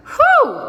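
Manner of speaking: loud, high "whew" of release; sudden high whoop sliding down. Retold or imitated. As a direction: let out a big hooting whew. Imitates relief after the birth.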